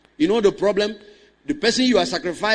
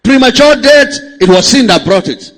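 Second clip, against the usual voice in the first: loud, driving pulpit delivery; forceful preaching; 6 semitones higher, 14 dB louder than usual. Acted. Pastor preaching to the congregation, forceful preaching. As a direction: preach it hard to the church.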